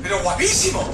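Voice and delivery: usual speaking voice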